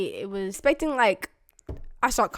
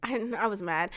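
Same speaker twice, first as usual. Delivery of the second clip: usual speaking voice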